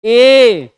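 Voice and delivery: ए called out loud and long; demonstrated vowel. Shown as a demonstration. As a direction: voice ए strongly so the tongue presses the palate. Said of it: "जैसे आप बोलेंगे".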